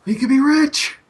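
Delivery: high, eager gnome voice. Acted. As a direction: beg like a greedy little gnome. Gnome pleading in character.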